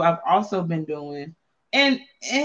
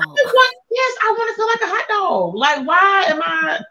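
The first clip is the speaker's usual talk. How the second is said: very high, playful outburst; excited mock squeal; 12 semitones higher, 3 dB louder than usual. Joking with co-host about wanting a hotter sauna, high comic squeal.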